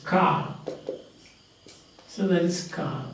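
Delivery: "Car" ending on an open long vowel; silent final R. Shown as a demonstration. Teacher showing R is not realized at word end, silent R. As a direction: leave the R off "Car". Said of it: "never pronounced at the end of".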